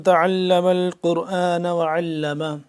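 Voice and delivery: hadith recitation. Acted. Opens lesson reciting a hadith.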